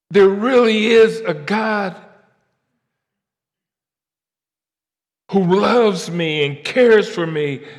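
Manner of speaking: high, loud, slow preaching; preaching at full force. Acted. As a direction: build to a revival climax. Preaching climax.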